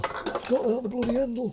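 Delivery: squeezed, high-pitched dismay; pained exclamation. Real, not acted. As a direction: let it climb into a squeaky complaint. Sudden yelp over a pan handle.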